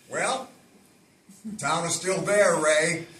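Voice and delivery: booming David Lynch voice; David Lynch impression. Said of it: "And he said".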